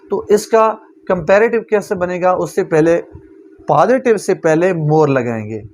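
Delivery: ordinary talk, nothing marked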